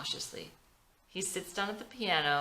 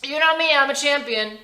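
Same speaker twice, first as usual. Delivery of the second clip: hiding pain; 8 semitones higher, 10 dB louder than usual. Acted. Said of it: "tries to hide the fact".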